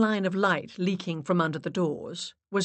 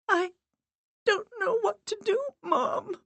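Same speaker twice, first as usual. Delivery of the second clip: high, tearful plea; voice breaking. A narrator's reading. Miranda to her dead mother, voice breaking. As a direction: break down as you confess it. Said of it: "Miranda's voice broke".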